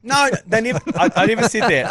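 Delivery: high, loud, indignant protest; indignant denial. Real, not acted. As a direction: squeal out the denial in mock outrage. Denying accusation loudly.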